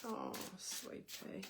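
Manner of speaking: soft, slow, breathy sympathy; tender whisper. Real, not acted. Psychic comforting a heartbroken viewer, whispered and slow. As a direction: hush it out to the struggling viewer, slow and gentle.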